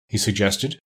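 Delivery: ordinary delivery, nothing marked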